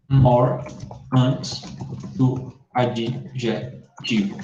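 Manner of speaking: very slow, spaced, word by word; slow dictation. Shown as a demonstration. Teacher dictates the rule for students to copy, slow and spaced. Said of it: "dictating the rule slowly".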